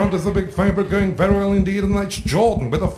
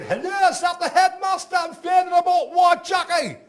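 shouting Geordie dad; 10 semitones higher than usual. Retold or imitated. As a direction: bellow down the phone in broad Geordie. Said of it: "he said".

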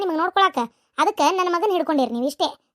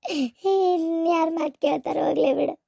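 slow, sobbing complaint; tearful lament; 3 semitones lower, slower than usual. Acted. Hurt mother complaining that nobody listens, tearful lament. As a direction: drag the words out through tears. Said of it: "ಯಾಕ್ ಹಿಂಗ್ ಅಳಾಕತ್ತೀಯ".

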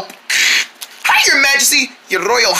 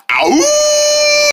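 pained yelp; 4 dB louder than usual. Acted. Ad character yelps in pain.

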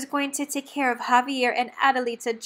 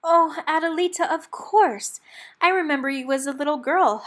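higher, louder delighted recognition; gasped surprise; 5 semitones higher, 7 dB louder than usual. A narrator's reading. Javier suddenly recognizing Adelita, a loud surprised gasp. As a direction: gasp as if amazed to recognize her. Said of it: "Javier gasped".

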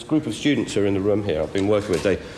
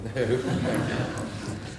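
soft laugh; 6 dB quieter than usual. Real, not acted. Laughs at own warning to committee.

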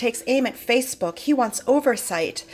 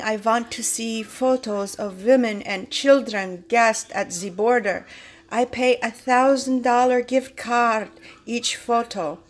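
mock foreign accent, "want" with a v, a bit slower; George Soros mock accent. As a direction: repeat the villain quote in a light foreign accent. Voicing George Soros's caption again.

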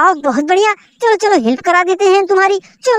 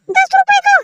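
Superman toy voice; 13 semitones higher than usual. Pretend. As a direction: squeak the price like the little Superman shopkeeper. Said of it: "ये कितने का है भाई?". (Superman खिलौने की बहुत ऊँची पतली आवाज़)

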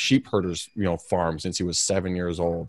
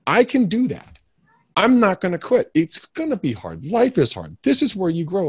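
determined; 10 semitones higher than usual. Retold or imitated. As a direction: psych yourself up. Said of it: "So I'm like".

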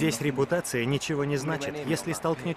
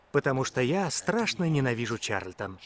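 ordinary talk

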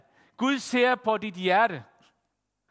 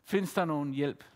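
softer, lower recollection of the shout; soft echo of a cry; 6 semitones lower, 6 dB quieter than usual. Retold or imitated. Preacher recalling the woman's cry, quiet and low. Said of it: "skreg ud og råbte".

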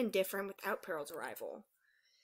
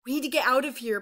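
loud, higher alarmed cry; urgent shout; 4 semitones higher, 8 dB louder than usual. A narrator's reading. Peril to her sister as Bermuda nears, shouting urgently. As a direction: shout a warning to flee. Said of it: "Peril shouted".

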